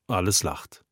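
ordinary delivery

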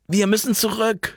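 high shout over the noise. A narrator's reading. Jan shouting to Alva as bombers near. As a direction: yell it urgently. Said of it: "schrie Jan".

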